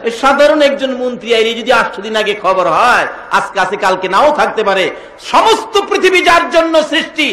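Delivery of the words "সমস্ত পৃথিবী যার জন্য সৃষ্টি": high, forceful preacher's delivery; full-force preaching. Acted. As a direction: drive the question home to the crowd.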